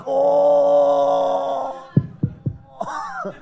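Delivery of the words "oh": mock overdose moan; mock overdose groan. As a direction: moan it like someone fading out. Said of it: "the sounds are like".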